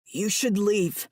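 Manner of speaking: trapped man short of breath; between gasping breaths. A narrator's reading. Trapped man urging her to go, lightly gasping. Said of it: "between gasping breaths".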